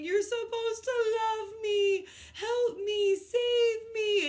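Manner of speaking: high, loud, crying plea; retold shouting. Teacher replaying her shouts the coach heard on phone. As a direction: shout it like a desperate kid. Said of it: "to me shouting".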